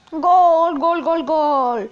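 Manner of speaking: loud drawn-out goal call; screaming football commentator. Real, not acted. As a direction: yell each gol like a stadium announcer. Goal scored while playing.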